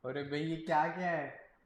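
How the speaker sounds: normal talking voice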